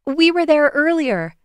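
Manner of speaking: put-on high, loud, eager girl; excited announcement. A narrator's reading. Bailey telling Amaya about the stage, excited. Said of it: "Bailey announced excitedly".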